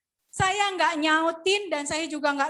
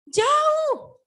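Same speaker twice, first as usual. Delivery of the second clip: high, loud shout on one word; emphatic cry; 11 semitones higher, 8 dB louder than usual. Real, not acted. Preacher telling congregation they are far from God, high emphatic shout.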